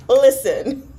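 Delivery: laughing through the word; giggling, half laughing it out. Real, not acted. Laughing while repeating.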